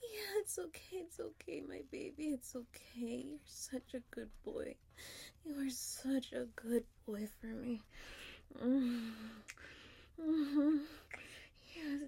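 ordinary delivery

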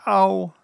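high, loud "ow" on landing; bumped ow. A narrator's reading. Piglet bumped down in the pocket, yelped ow. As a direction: yelp ow as the bump lands.

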